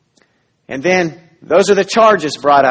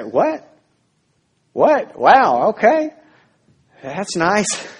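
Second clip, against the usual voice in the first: incredulous double take, pitch jumping higher; stunned disbelief; 5 semitones higher, much slower than usual. Retold or imitated. The guilty one hearing not guilty, stunned disbelief. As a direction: react like you can't believe the verdict. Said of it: "And you say".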